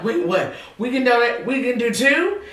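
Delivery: retold thrilled disbelief; imitated excitement. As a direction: act out someone so excited, voice rising. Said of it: "She was so excited".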